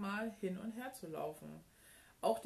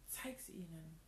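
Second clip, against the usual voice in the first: soft whisper; 7 dB quieter than usual. A narrator's reading. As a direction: say it softly, as if half whispering. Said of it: "flüsterte sie". (leise geflüstert)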